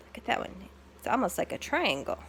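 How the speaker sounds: ordinary delivery, nothing marked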